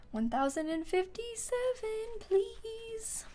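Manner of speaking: soft, high, slow, drawn-out pleading; hushed plea. Real, not acted. Streamer hoping before island one thousand fifty-seven, hushed plea. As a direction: wish for luck in a tiny rising voice.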